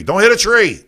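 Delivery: loud, high yelled warning; mock warning shout. Host to Lisa at the wheel, mock warning shout. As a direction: yell it as a playful alarm. Calls warning to driving listener.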